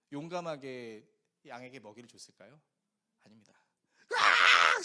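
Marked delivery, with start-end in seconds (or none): 4.1-4.8 a loud, low, scared scream of "으악"; retold scream. Pastor retelling his son's scream at the sheep, loud scream. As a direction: let out a child's frightened yell. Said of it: "소리를 지르기 시작했어요".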